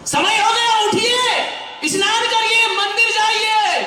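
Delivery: high, sweet coaxing wake-up call; sing-song loving wake-up for father-in-law; lovingly. Retold or imitated. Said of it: "इतने प्यार से बोल रही है।". (ऊँची, मीठी, प्यार भरी आवाज़)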